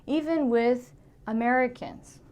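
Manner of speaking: usual speaking voice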